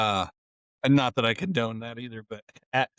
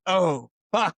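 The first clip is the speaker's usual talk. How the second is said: high, imitated shock, a touch louder; shocked curse; 7 semitones higher, 3 dB louder than usual. Friend recalling the crowd surfer hitting the ground, imitated shock. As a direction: replay the moment of seeing it happen. Retelling watching girl slingshot down.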